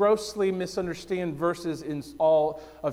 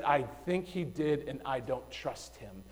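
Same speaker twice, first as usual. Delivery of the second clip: quiet mock confession; 3 semitones lower, 10 dB quieter than usual. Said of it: "Adam and Eve said".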